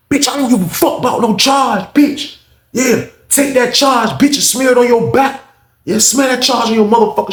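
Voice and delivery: mock yelled taunt, pitched high; high, heated trash talk, acted out; acted angry taunting. Inmate taunting the officer about a charge, angry.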